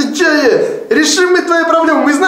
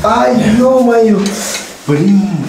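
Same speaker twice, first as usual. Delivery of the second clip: slow pained groan after a fall; tripping and falling; 3 semitones lower, much slower than usual. Acted. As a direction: drag it out, hurt from the fall. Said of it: "башкой ударился".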